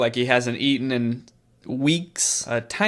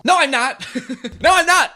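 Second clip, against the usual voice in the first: higher and louder protest; indignant shout; 12 semitones higher, 4 dB louder than usual. Acted. Mia shouting at Becky that she is not crazy, indignant and loud. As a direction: insist hard, shouting back. Acted quarrel between game characters.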